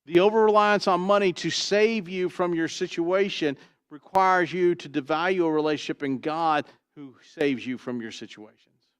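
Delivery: usual speaking voice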